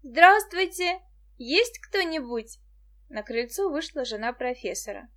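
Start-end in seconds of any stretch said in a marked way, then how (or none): 0.0-2.6 loud, high call, drawn out at the end. A narrator's reading. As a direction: call to see who's home. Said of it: "крикнула". (громкий оклик)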